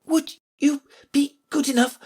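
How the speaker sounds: put-on high, broken girl's voice; halting, winded polite plea; panting. A narrator's reading. Alice begging the king to stop, panting. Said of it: "Alice panted out".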